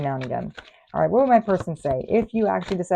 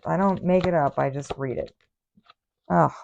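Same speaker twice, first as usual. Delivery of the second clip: normal talking voice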